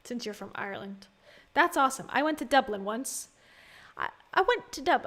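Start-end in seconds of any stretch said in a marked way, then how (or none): 4.0-5.1 high, abandoned stab at Irish accent; tentative put-on Irish lilt; attempted Irish accent. Shown as a demonstration. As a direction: try an Irish accent and break off. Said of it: "not even trying to do the accent".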